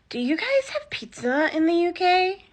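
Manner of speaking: high-pitched, sincere question; earnest question. Retold or imitated. A dude at a pizza place asking a Brit, earnest and high-pitched. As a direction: ask it in all seriousness, high. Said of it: "not even sarcastic".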